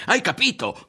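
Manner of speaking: high, loud demanding question; a loud, high Hai capito?. A narrator's reading. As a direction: press him, loud and high. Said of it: "fu sul punto di gridare".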